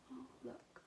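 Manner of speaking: soft unvoiced aside; whispered aside. Real, not acted.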